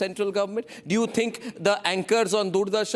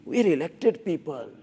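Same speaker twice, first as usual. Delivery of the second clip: lightly voiced smug politician; mock politician; 5 semitones lower than usual. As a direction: parody a preening elected official. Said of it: "you know how they talk?".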